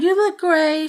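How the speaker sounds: mock fake compliment.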